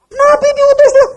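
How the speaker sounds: high, loud ecstatic cry; prisoner yelling. Retold or imitated. Prisoner shouting at the preaching doctor, loud high cry. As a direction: shout it like a man possessed in a cell. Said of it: "teriak-teriak lagi di penjara".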